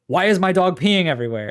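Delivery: loud, indignant complaint in a retold voice; imitated yelling. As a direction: yell it like a baffled dog owner. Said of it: "the guy starts yelling at you".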